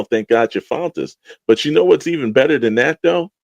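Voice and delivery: usual speaking voice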